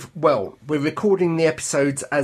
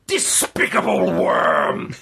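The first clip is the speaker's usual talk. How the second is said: slow, higher theatrical shout; hammed-up insult; 4 semitones higher, slower than usual. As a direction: hurl it as a drawn-out melodramatic insult. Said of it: "the characters start insulting each other".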